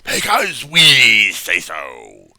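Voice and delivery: slow, menacing cult leader; dramatic acting. Shown as a demonstration. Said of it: "Notice the dramatic acting at this point".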